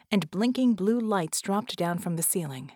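plain reading voice

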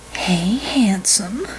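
slow breathy whispered purr; breathless purr. Acted. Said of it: "she purred breathlessly".

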